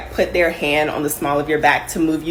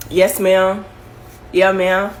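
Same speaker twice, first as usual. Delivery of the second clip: Southern yes ma'am; much slower than usual. Retold or imitated. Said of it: "they're like".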